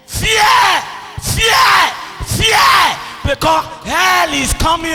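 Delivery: emphatic preacher's cry of warning; forceful warning. Real, not acted. Preacher warns sinners of hell, forceful warning. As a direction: ring out the warning to everyone.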